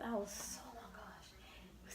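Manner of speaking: whispered exasperation. Real, not acted. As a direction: breathe it out in a whisper. Recalling pain.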